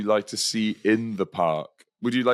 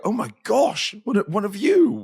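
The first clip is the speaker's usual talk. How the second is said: much higher, far plummy exclaim; posh gasp; 9 semitones higher, 3 dB louder than usual. Retold or imitated. Posh person meeting a northerner, high and shocked. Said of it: "Sometimes they go".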